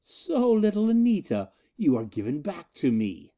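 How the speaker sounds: high, crooning possessive gloat; triumphant possessive. Acted. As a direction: croon over his prize. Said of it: "triumphant possessive caresses".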